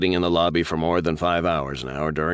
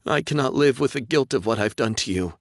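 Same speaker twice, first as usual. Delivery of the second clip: tearful; 8 semitones higher than usual. Acted. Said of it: "she sobbed".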